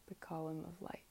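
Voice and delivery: whispered guidance. Shown as a demonstration. Teacher whispering to students during meditation. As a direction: breathe the words out softly. Guided mantra visualization.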